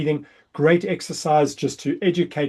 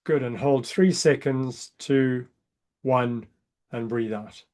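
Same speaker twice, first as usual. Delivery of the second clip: breath countdown; slower than usual. Shown as a demonstration. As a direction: count down and let them breathe out. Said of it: "I'll count you down".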